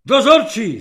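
high, very loud shout for the duty man; rasping bellow. A narrator's reading. Officer yelling for the duty sergeant, rasping shout. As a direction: roar it out in a choked burst. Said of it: "zachrčel na dokonale zblblého".

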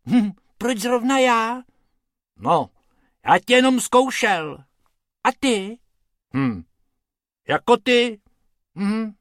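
high, loud worried cartoon rabbits; high-pitched, agitated back-and-forth; little rabbits' voices. A narrator's reading. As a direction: squeak it like two anxious small rabbits. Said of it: "Bob s Bobkem zoufale pobíhali po břehu".

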